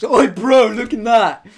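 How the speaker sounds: high, loud cheeky shout; loud call. Retold or imitated. Retold luring friend to look.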